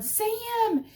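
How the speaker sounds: high call for the dog; yelling a dog's name. Retold or imitated. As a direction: shout the name out the door. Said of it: "And I'm yelling".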